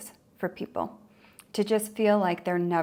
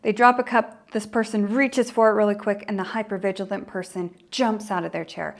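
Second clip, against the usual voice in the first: usual speaking voice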